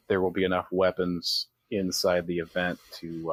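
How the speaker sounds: normal talking voice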